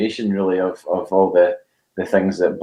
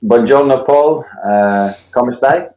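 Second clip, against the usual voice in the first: higher and louder, hammed-up Italian greeting; mock Italian greeting; 4 semitones higher, 4 dB louder, slower than usual. [name] greets Paul on air in hammed-up mock Italian. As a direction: put on a playful Italian host welcome. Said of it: "that's about the extent of my Italian".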